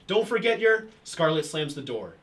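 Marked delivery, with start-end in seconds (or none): 0.1-0.9 raised, higher-pitched call after someone; lightly acted call-out. A narrator's reading. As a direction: read the line as a call to someone leaving. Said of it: "George calls out to her".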